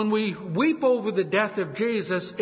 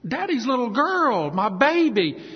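higher, louder grieving father; acted anguish; 7 semitones higher, 4 dB louder than usual. Father told of his daughter's death, acted anguish. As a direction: cry out for your little girl, acted. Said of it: "just breaks down and cries".

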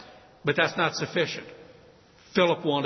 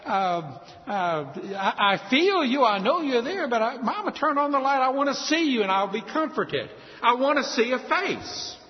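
high, put-on child voice; crying little girl; 8 semitones higher than usual. Little girl begs mother for light, high pleading child voice. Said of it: "what are you crying about".

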